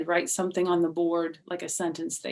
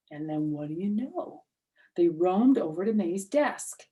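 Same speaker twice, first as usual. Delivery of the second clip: usual speaking voice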